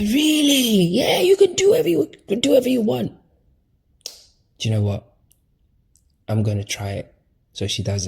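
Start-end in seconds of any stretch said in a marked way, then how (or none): 0.0-3.1 put-on much higher, wide-eyed girlish voice; naive Eve voice. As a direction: play Eve as a naive girl, pitched way up. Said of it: "And she's like".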